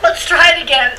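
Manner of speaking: usual speaking voice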